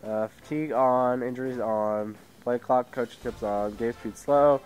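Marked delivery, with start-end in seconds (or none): none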